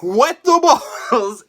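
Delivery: loud, high disbelieving yell; the boys yelling at Mom's cruelty; shocked outburst. Retold or imitated. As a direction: shout it out in stunned disbelief. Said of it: "Norman and Ray are like".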